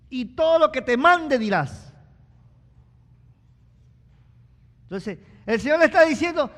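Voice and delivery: full-force pulpit cry; fiery preaching. Acted. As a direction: drive it home to the congregation.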